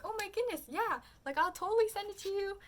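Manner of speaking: high-pitched, eager agreement; excited. Retold or imitated. Trader agreeing to send the photo card, excited. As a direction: jump at it, voice soaring. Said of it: "we were so excited".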